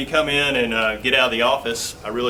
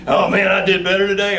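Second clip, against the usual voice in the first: mock golfer; 7 semitones higher, 6 dB louder than usual. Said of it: "You're constantly trying to beat your own self".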